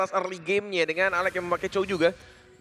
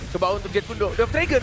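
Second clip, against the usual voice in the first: rising hype, high and loud; excited shout; 4 semitones higher, 4 dB louder than usual. Real, not acted. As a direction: yell the play call. Caster calls big play.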